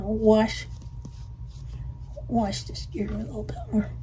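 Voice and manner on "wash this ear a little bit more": quick whisper to yourself; soft fast whisper; whispered aside. Acted. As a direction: hush it while grooming. Grooming aside.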